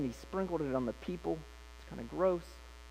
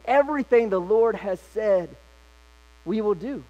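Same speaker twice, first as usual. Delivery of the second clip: eager pledge; 5 semitones higher, 7 dB louder than usual. Retold or imitated. People pledging eager obedience.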